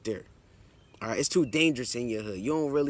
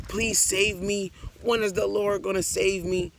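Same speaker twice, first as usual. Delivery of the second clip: acted pleading cry; 6 semitones higher than usual. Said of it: "we was probably crying to the Lord every day".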